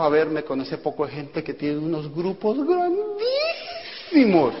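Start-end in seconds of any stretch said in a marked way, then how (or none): 2.7-4.5 slow, high, stretched with a laugh; amazed slow drawl; drawn-out awe. Real, not acted. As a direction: stretch the word to show how huge. Stretched awe at huge groups.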